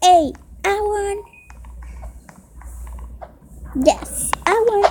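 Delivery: high, repeated cheer; happy victory. Real, not acted. Kid telling viewers of the win, high happy cheer. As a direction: savour the win. Winning round.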